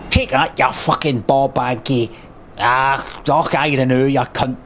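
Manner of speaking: a light Scottish game-voice insult; a hint of a Scottish fighter; Scottish voice line. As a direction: snarl it like a Scottish brawler. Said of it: "a kinda Scottish, you know".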